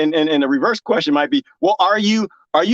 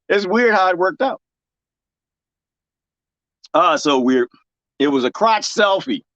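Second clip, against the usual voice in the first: usual speaking voice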